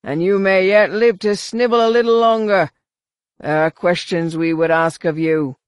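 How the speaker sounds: war leader's booming threat; bellow. A narrator's reading. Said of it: "the war leader bellowed".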